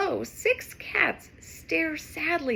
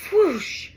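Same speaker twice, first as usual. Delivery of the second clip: loud whoosh; 5 semitones higher, 6 dB louder than usual. Acted. Flying sound effect.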